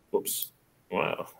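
ordinary talk, nothing marked

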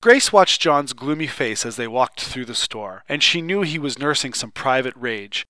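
ordinary delivery, nothing marked

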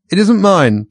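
high, loud nervous protest; Hatter voice. Acted. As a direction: squeak it out defensively.

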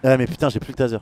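usual speaking voice

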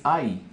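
"ay" said as English long I, like "eye"; natural vowel sound. Shown as a demonstration. Teacher shows learners the vowel's natural sound, "ay" as "eye". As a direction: say "ay" as one gliding English vowel. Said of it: "su sonido natural es".

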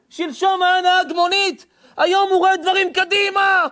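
sustained, drawn-out warning cry; full-force preaching. Real, not acted. Preacher warns people off fake kabbalists, thundering. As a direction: preach it forcefully, stretching the phrase ends.

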